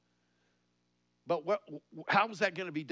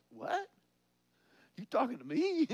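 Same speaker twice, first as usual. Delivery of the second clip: high, startled what, then you talking to me; startled disbelief; 9 semitones higher than usual. Acted. Moses woken up and startled by God's call. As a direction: jolt up in shock, pointing at yourself. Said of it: "Moses wakes up and he says".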